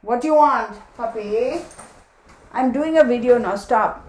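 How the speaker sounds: loud scolding. Real, not acted. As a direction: raise the voice at the dog. Scolding the pawing dog.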